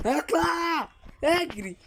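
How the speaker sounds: high, panicky cries; real fright while fleeing; frightened. Real, not acted. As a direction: squeal in fear as it chases you. Frightened by monster.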